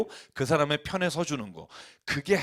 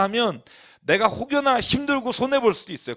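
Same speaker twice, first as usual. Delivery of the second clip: ordinary talk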